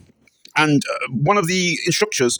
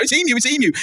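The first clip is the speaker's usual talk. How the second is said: mock excited cry; 9 semitones higher than usual. Puppet attack at funeral.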